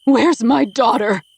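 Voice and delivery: slow, loud, high scream; slow, loud mother screaming at her daughter's captor. Acted. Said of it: "Kira screams at him".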